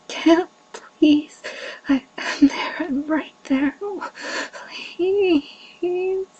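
high breathless pleading; breathless begging. Acted. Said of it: "Breathless, begging".

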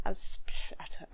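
usual speaking voice

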